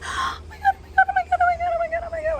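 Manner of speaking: very high, breathless repeated squeals; starstruck squealing. Retold or imitated. Frozen before her idol, squealing very high. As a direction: squeal it over and over, frozen with awe.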